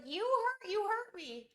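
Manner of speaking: high, whiny dog voice; voicing the dog. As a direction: play the wounded pup. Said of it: "He's like".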